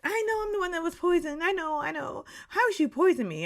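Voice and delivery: high-pitched, whiny panicked wail; mock freak-out. Housemate sure she will be picked as the poisoned one, mock freaking out. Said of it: "Akansu was just freaking out".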